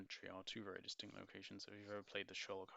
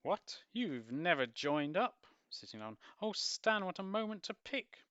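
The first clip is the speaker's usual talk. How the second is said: shocked mother; 10 semitones higher, 9 dB louder, slower than usual. Acted. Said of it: "Missus Chivers".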